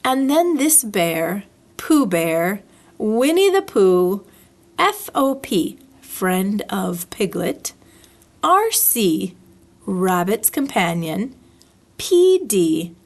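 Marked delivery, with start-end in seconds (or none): none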